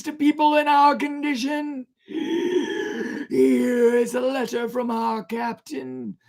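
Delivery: high, loud, slow anguished plea; passionate calling out. Acted. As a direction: cry out the plea in desperation. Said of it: "called out passionately".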